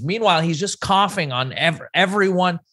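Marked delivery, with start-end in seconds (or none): none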